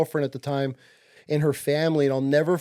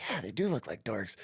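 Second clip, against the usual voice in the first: lower, much softer, sheepish; meek agreement; 4 semitones lower, 11 dB quieter than usual. Retold or imitated. Eighteen-year-old agreeing with the recruiter, soft meek mumble. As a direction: mumble it like a swayed kid.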